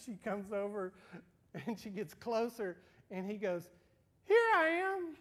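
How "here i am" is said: put-on very high, very loud childish cry; squealed little boy's reveal; toddler's triumphant shout. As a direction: burst out like a delighted two-year-old.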